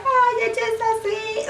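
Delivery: high, sugary fake sweetness; playing it off. Girl deflecting his advances, high fake sweet voice. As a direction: brush him off with a bright fake coo. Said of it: "I'm trying to play it off".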